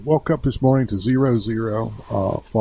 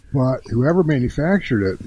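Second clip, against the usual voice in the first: normal talking voice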